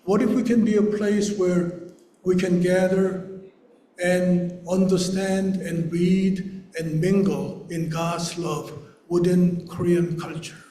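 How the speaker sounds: normal talking voice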